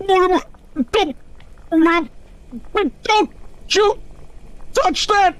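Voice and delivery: high, furious yell; angry shout. Acted. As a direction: bark it out in alarm. Father guarding his lamp.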